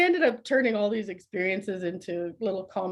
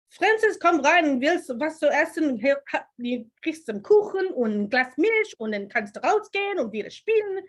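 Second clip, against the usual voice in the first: German mother calling her son, higher and louder, sounding harsh; stern German mother; 7 semitones higher, 6 dB louder than usual. Retold or imitated. Mother calling her son in from play, in brisk German. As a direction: bark the German like a brisk, scary-sounding mom. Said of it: "It's the way she was presenting it. That tone".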